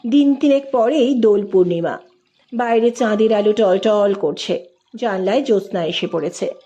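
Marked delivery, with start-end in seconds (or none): none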